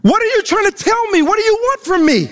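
frustrated widow crying out to God; exasperated widow. Acted. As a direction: rush it out like she's at the end of her rope. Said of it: "Let me say that in a modern day way".

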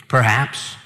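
normal talking voice

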